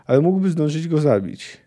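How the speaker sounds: plain reading voice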